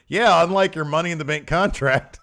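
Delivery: loud, high retort, imitated; mock comeback. Host retells a wrestler shooting back at his rival, mock comeback.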